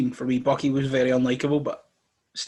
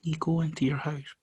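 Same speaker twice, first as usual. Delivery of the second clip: ordinary delivery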